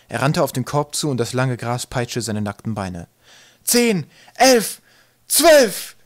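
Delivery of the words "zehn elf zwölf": high, very loud count; shouting. A narrator's reading. Twig counting as he leaps to the basket, shouting. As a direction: shout each number on the leap. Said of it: "schrie er und sprang hoch".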